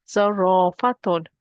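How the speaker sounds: slow Zarafatun, "raa" held long; stretched long vowel. Shown as a demonstration. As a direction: hold the second A of Zarafatun. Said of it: "I'm gonna stretch that".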